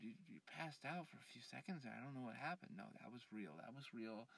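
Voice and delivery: ordinary delivery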